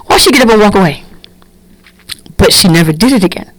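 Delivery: usual speaking voice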